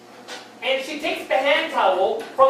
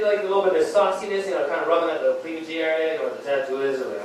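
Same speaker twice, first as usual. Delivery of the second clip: ordinary delivery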